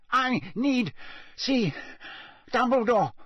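put-on crazed man clutching the boy's robes; desperate pleading. A narrator's reading. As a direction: beg through exhaustion. Crouch pleading, mad.

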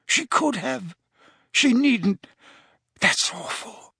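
put-on a fussy old man fighting tears; shaken Slughorn. A narrator's reading. Slughorn, upset, reacting to Lily's choice.

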